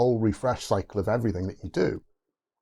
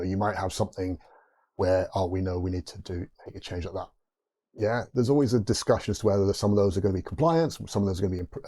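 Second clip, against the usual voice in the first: ordinary talk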